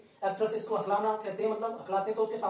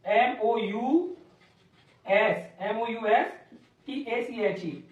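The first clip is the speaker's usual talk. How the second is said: spelling out; much slower than usual. Shown as a demonstration. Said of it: "teacher spells word letter by letter".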